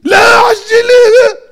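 high, loud, slow whiny plea; pleading wail. Acted. Driver begging the policeman over the fine, mock crying plea. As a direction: beg the cop like a caught kid.

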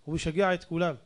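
ordinary talk

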